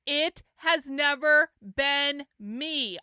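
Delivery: NEVER BEEN ME dragged out word by word; emphatic. Real, not acted. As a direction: hammer each word of "never been me", very slowly. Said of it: "I cannot emphasize this enough".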